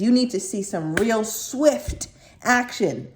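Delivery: "swift" slowed down and leaned on; stress on swift. Shown as a demonstration. Reader tells Virgo they need action, leaning on "swift". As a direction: drag out "swift" with weight, slow pace. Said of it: "emphasis on swift".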